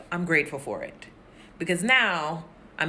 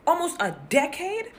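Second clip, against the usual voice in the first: incredulous question; 9 semitones higher than usual. Acted. Continues incredulous echo.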